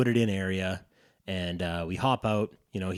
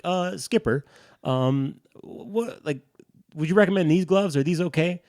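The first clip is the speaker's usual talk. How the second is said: higher-pitched, hesitant imitation of a friend; tentative question; 9 semitones higher, 4 dB louder than usual. Friend checking his gloves with the guide, imitated as hesitant. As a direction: ask politely with halting fillers, pitched up.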